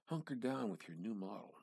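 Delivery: usual speaking voice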